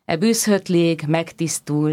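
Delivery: usual speaking voice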